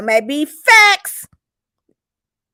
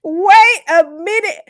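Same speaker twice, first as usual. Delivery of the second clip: slow and high, less squealed; stunned, regaining control; 8 semitones higher, 4 dB louder, slower than usual. Real, not acted. Host steadying herself after gossip, drawn-out astonishment. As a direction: stretch each word in lingering disbelief. Repeats it, coming down.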